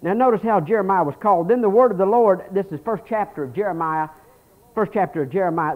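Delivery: ordinary delivery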